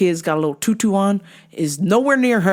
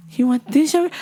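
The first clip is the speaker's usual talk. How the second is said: high-pitched mock-mom question, rising; coaxing mother imitation; 8 semitones higher than usual. Mother offering her child yogurt, high mock coaxing.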